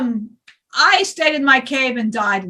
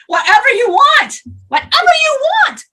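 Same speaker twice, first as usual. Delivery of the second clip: loud, high, rushing mock host; hammed-up offer; 11 semitones higher, 6 dB louder than usual. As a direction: sell it like an overeager bartender. Mocking hosting offer.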